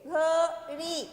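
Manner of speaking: घड़ी in two slow beats, long final ee; drawn-out long vowel. Shown as a demonstration. Teacher modelling घड़ी for children, last vowel drawn out. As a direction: stretch the ड़ी of घड़ी. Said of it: "खींच के बोलेंगे".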